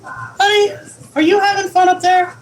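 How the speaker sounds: high-pitched cooing question; sweet pet talk. Real, not acted. Owner to the pet climbing up high, high sweet cooing. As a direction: coo to a beloved little pet.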